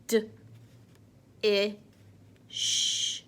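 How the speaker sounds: D, I, sh given one at a time; sounding out words. Shown as a demonstration. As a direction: sound each letter apart. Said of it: "Let's do our sounds".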